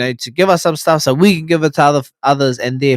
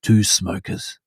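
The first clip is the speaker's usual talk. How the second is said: low, slow epic trailer narrator; movie trailer voice; 5 semitones lower than usual. Retold or imitated. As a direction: announce it like a blockbuster trailer. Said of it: "more like movie trailer".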